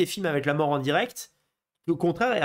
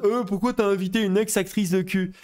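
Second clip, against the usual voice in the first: higher, lightly caricatured complainer; mock outraged viewer; 5 semitones higher than usual. Nationalist viewers questioning the guest choice, mock outrage. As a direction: imitate an indignant viewer's protest. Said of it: "ils ont là en mode".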